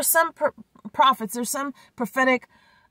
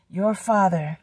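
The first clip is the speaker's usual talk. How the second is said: ordinary talk, nothing marked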